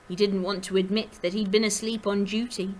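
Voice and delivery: ordinary delivery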